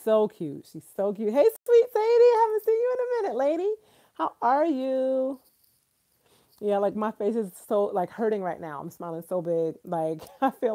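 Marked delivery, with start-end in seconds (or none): 1.3-5.4 high, louder, sing-song hello to a friend; sweet greeting. Real, not acted. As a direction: coo a delighted welcome. Greets a viewer in chat.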